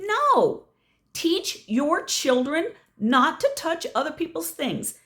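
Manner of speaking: indignant comeback in a retold quarrel; retold indignant refusal. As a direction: firmly scold a parent, retelling it. Retold quarrel, answering the woman back.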